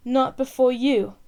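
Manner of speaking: cried out. Acted. Prince reviving and defying the woman, a loud slow cry. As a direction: throw it back at her as he comes to. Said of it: "cried the prince".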